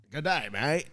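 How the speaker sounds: hammed-up Aussie accent; Australian accent. Shown as a demonstration. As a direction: call it out in faked Australian. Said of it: "I wanna play an Aussie".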